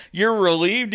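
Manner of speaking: high incredulous echo question; acted-out astonishment; retold disbelief. Acting out disbelief at reply.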